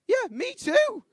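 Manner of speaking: squeaky high, loud chirpy agreement; mock cheery. Mock-cheery reply to debtor.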